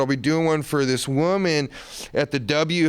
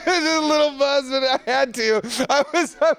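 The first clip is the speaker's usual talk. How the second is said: sheepish laughter, pitched higher; laughing; 9 semitones higher than usual. Real, not acted. Guest laughing off the host's drunk-painting question. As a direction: giggle through the admission.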